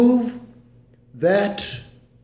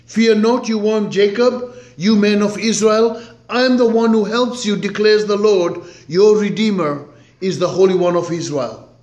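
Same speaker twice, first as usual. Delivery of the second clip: raised, louder scripture reading; preaching scripture; 5 semitones higher, 4 dB louder than usual. Acted. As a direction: proclaim the verses to the congregation. Reads scripture at full force.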